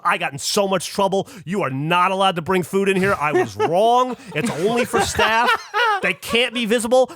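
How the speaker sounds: retold agitated insistence, pitch held up; flustered staff lady. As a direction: act out a staffer urgently backtracking. Acted flustered lady ordering them out.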